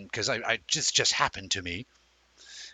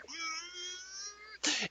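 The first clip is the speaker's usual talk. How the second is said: straining hum; 12 dB quieter than usual. Pretend. Wishing hard.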